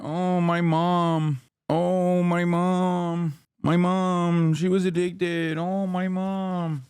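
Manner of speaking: mocking the senator as a whiner; mock whining. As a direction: whine it out like a crybaby. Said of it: "he's such a whiner".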